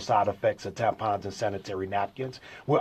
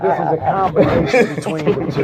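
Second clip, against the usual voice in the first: laughing while answering; 5 semitones higher, 8 dB louder than usual. Real, not acted. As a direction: say it through a laugh. Laughs about his morning voice.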